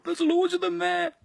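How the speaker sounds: very high, slow comic squeak; high silly voice. Acted. Pointing visitors to Chinatown, high silly voice. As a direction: stretch it out way up high.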